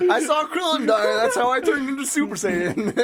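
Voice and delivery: high, loud, hammed-up declaration; acted anime hero. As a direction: cry it out as an overwrought cartoon hero. Acts out Gohan's traumatic memory.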